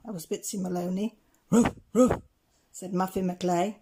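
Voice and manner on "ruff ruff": loud ruff ruff with a put-on dog voice; gruff dog bark. A narrator's reading. Muffin McLay joins the din, loud barking. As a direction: bark it out hard.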